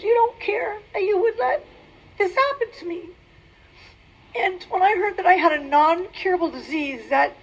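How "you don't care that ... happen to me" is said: higher, tearful plea to God; reliving a hurt accusation at God; tearful reproach. Retold or imitated. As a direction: cry out to God, pitched high. Tearful retold complaint to God.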